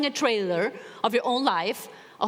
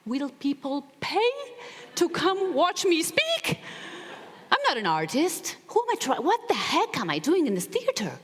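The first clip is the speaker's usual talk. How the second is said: rising, panicky self-doubt, pitched up; anxious inner voice; 6 semitones higher than usual. Retold or imitated. Alone before the show, anxious self-doubt in her head. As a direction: spiral into worry. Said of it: "obsessing around the thought".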